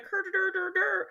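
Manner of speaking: high dopey "her der der" noise; dopey mock babble. Imitating the clueless woman as dopey babble. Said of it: "she's just like".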